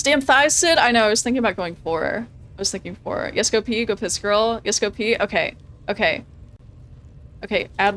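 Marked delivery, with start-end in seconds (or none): none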